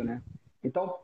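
normal talking voice

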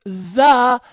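high, loud, word-by-word repeat; exaggeratedly slow, as to someone who can't understand; mock slow explaining. Co-host playing the impatient girlfriend, loud and slow. Mocking louder, slower repeat of slang.

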